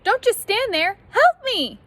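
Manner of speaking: high, loud panicked call; cry for help. Acted. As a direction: shout for help from under the pile. Trapped character crying for help.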